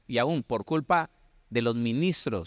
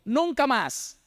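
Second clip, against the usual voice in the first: high indignant never again; outraged vow; 10 semitones higher, much slower than usual. Retold or imitated. Minister fed up with the drunk, high outraged cry. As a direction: hurl it out like a man fed up. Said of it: "se rasgó las vestiduras".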